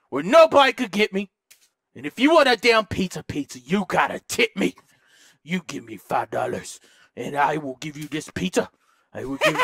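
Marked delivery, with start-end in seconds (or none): 5.4-9.6 very low, quiet offer; hushed bargaining. Real, not acted. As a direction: make the offer low and softly. Pizza man bargaining nervously.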